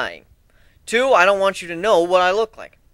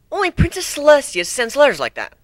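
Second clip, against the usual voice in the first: higher pony voice; insist, affronted; indignant unicorn; 10 semitones higher, 4 dB louder than usual. Acted. Twilight insists to Griffin, a higher indignant voice.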